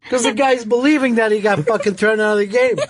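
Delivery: ordinary talk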